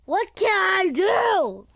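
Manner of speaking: loud, high, slow desperate plea. Real, not acted. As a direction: cry it out helplessly. Panicking in the game.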